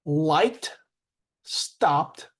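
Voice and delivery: "Liked", "stopped" separately, ending in T; T-ending demo. Shown as a demonstration. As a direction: model the final T in "Liked" and "stopped". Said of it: "there are three sounds".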